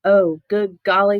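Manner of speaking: usual speaking voice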